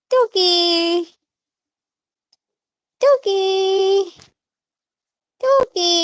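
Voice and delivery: high singsong peekaboo call. Real, not acted. Playing peekaboo with baby.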